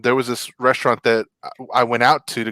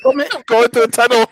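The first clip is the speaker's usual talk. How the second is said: high mock phone call broken by static; faked bad connection; 12 semitones higher, 4 dB louder than usual. Caller pretending to be in a tunnel, faking static. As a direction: hiss fake static between high-pitched phrases.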